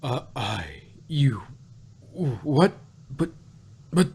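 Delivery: flustered, broken stammer rising; stammering shock. Acted. Celestia hearing the cake secret, stunned stammer. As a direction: lose your words in disbelief.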